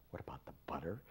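baffled whisper to himself. Retold or imitated. Repeating the guru's question under his breath, whispering. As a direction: whisper the question back, puzzled.

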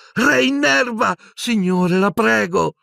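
high, drawn-out spell, then begging; desperate pleading cry. Acted. Boy trying to revive his professor, desperate pleading. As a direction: cry out and implore him.

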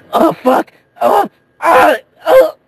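high, loud panicked yelp; sudden terrified shriek; panicked cry. Acted. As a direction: cry out as the plane dives. Drunk pilot about to crash.

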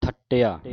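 ਥੱਟਿਆ said loud with a doubled ਟ; tight geminated consonant. Shown as a demonstration. Teacher correcting a loose reading, ਥੱਟਿਆ loud and tight on ਟ. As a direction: punch out ਥੱਟਿਆ, holding the ਟ. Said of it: "ਮਤਲਬ ਕਿ ਕੱਸ ਕੇ ਬੋਲਣਾ".

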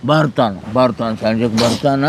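low, comforting voice; reassuring. Acted. Reassures child about absent father. (ಭರವಸೆ ನೀಡುವ ತಗ್ಗಿದ ಧ್ವನಿ)

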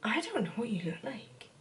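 whispered, breathy aside. Real, not acted. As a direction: drop to a whisper. Whispered aside during makeup.

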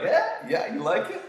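high, loud, pleased-with-himself comeback; cocky playful reply. Retold or imitated. Teacher answering laughing students, playfully cocky. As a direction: play up the vanity, pitch jumping up.

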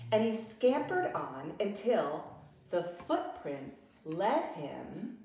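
usual speaking voice

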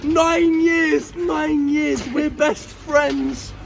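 put-on slow, overblown drunken declarations of friendship; Summer whilst drunk. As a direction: play a clingy drunk begging for attention. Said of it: "Summer whilst drunk".

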